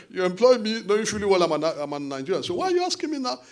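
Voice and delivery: doing his voice with a laugh in it; hammed-up imitation of the politician; imitating Jagaban. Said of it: "you know how he talks".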